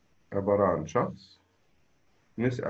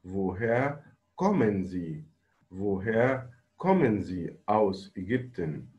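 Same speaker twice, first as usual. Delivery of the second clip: very slow, clear German; slow model answer; much slower than usual. Shown as a demonstration. Teacher models the correct answer for students, very slowly. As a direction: read it word by word for the class. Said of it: "برافو".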